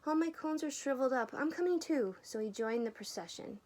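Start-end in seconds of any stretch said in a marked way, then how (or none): 0.1-2.2 high, fast chattering squirrel; fussy squirrel. A narrator's reading. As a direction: rattle it off like a busy squirrel. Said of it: "scolded the squirrel".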